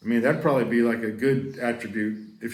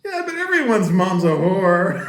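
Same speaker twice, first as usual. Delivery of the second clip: louder, laughing cheeky comeback; laughing retort; 7 semitones higher, 9 dB louder than usual. Retold or imitated. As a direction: toss it off with a laugh. Cheeky retort in retold fight.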